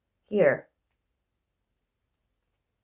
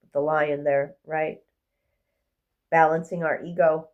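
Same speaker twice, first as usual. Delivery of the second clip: ordinary talk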